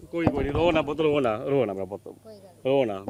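soft, tender hushing; gentle consoling. Real, not acted. Reporter to a tearful girl over her ruined books, softly consoling. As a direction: comfort softly, voice dropped low.

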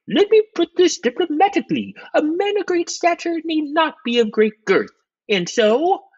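very high, put-on official voice; high-pitched, careful diplomat; diplomatic secretary of state. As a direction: tiptoe tactfully in a piping voice. Secretary of state figure, being tactful.

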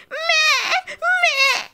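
very high bleating meh; goat bleat. Acted. Goat nipped by the crayfish, high startled bleat.

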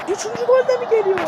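quick, high, rushing question; fast excited question. Pretend. Gamer asking if a third goal comes, fast and excited. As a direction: blurt out the hope.

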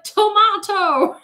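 drawn-out, high, loud shout; exasperated yell. Retold or imitated. Thirteen-year-old customer yelling at sandwich staff, exasperated. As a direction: lose patience and holler it slowly, English-style. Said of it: "I, like, yelled it out".

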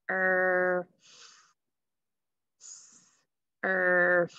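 "Er" held long within s... er... f; sounding out. Shown as a demonstration. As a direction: blend s, "Er", f slowly, each sound stretched. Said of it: "let's try that and see".

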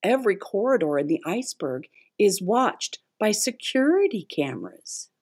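ordinary delivery

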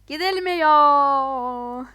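loud, very slow drawn-out whine; mock wail. Stuck on the bridge, a drawn-out mock whine. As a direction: stretch it into a mock lament. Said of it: "e, gidilmiyor".

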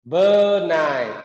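careful, loud model of Bơ nài; two slow syllables. Shown as a demonstration. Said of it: "Hai âm tiết".

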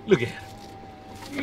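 slow whisper; whispered aside. Real, not acted. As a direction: hush it so she won't hear. Whispering about the cashier.

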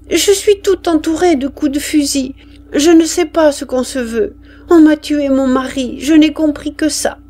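the widow tells of her husband's death; grieving mother. Acted. (veuve désemparée, voix plus aiguë)

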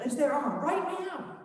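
high, pressing, breathless urgency; urgent pleading. Acted. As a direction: push hard, this is our only window. Disciples urging Jesus.

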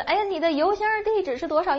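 high, lively model question. Shown as a demonstration. Teacher voices the question a student would ask, pitched high. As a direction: pitch it up like a real asker. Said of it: "那所以你再问".

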